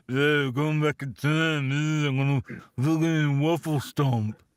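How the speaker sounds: slow, slurred mock Stallone mumble; Stallone impression. Host imagining Stallone as Doctor Who, slurred Stallone voice. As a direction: drawl it like Rocky. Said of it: "Can you imagine that?".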